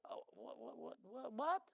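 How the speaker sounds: breathless, hushed what what what; mock stunned whisper; whispered disbelief. People hearing his Texan nickname, hushed disbelief.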